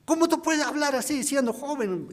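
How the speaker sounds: high, indignant outburst at a youngster; how dare you, boy, outraged; angry deacon. Retold or imitated. Deacon furious at the young preacher, indignant shout. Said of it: "se ha enojado conmigo".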